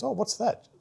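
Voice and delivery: high, surprised question; curious boss. Retold or imitated. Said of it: "And she goes".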